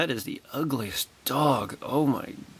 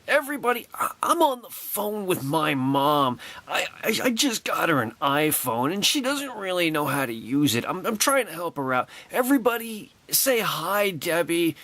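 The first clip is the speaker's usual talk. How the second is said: a hint of a chatty celebrity talking to her mom; imitating Carrie Fisher; 6 semitones higher than usual. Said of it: "she says to everybody".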